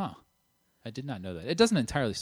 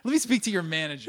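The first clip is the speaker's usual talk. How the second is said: entitled customer impression; 11 semitones higher, 7 dB louder, faster than usual. Mock entitled customer line.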